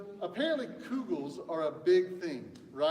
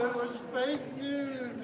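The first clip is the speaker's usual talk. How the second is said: slow, drawn-out announcement with weight on fake; slow dramatic reveal; 6 semitones higher, slower than usual. Real, not acted. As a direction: spell out "fake" slowly like a verdict. Announces quiz answer dramatically.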